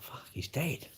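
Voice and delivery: whispered, breathless shock. Retold or imitated. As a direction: breathe it out as a horrified thought. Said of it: "I thought".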